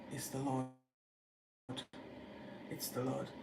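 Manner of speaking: soft, low, awed realisation; awed hush. Retold or imitated. John recognising Jesus from the boat, hushed awe. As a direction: breathe it out in wonder.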